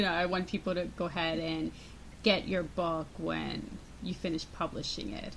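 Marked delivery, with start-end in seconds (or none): none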